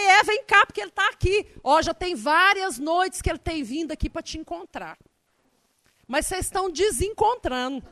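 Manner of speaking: high and loud, excited persuading; eager coaxing. Retold or imitated. Coaxing the stranger toward her friend, eager and loud. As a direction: sell it with bubbly enthusiasm.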